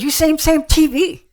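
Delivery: put-on high, loud Vietnamese-accented broken English; loud imitation of a Vietnamese accent; imitated Vietnamese English. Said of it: "That's the way they said it".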